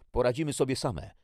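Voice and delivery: high, loud refusal; defiant outburst. A narrator's reading. Jackie rejects the stranger's offer.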